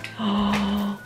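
a soft quick intake of breath; sharp gasp of surprise. Real, not acted. Seeing the bracelet in the box, a sharp gasp.

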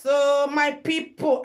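ordinary talk

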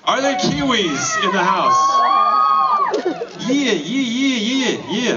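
put-on high laughing Kiwi accent; bouncy New Zealand accent. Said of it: "That's all I can do with a Kiwi accent".

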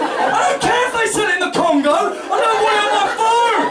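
high-pitched ranting down the phone; comic outraged meltdown; hammed-up angry shout. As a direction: yell it at the top of your range. Retold furious phone complaint, lost it.

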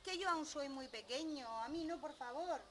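high, panicky pleading; frightened little goat. Acted. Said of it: "Y el otro, muy asustado".

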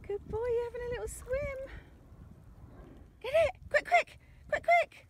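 high squeaky dog praise; squeaky praising a dog. Real, not acted. Excited praise of dog swimming.

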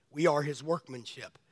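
preacher repeating the verse softly for reflection; soft echo. Shown as a demonstration. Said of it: "Think about that scripture".

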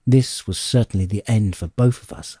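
usual speaking voice

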